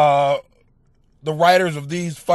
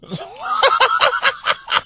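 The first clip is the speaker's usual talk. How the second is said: high airy burst of laughter; breathless squeal of a laugh; shrill laugh. Real, not acted. Podcaster cracking up at a news story, squealing laugh.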